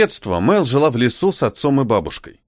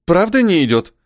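higher, mildly concerned question; worried asking back; 6 semitones higher, 3 dB louder than usual. A narrator's reading. Cynthia fretting about her looks, worried asking back. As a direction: ask back with a touch of worry. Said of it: "переспросила Синтия, озабоченно крутя головой".

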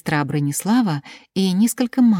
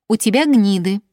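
raised, louder playground jeer; higher-pitched schoolyard name-calling; childish taunt; 5 semitones higher, 6 dB louder than usual. A narrator's reading. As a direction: tease it out like a mean little girl. Girls taunting the little sister.

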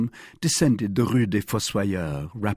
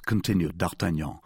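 ordinary delivery

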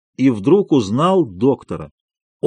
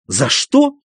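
much higher, outraged cry; shouted back; 12 semitones higher than usual. A narrator's reading. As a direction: hurl the question back at him. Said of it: "крикнул Иван Дмитрич".

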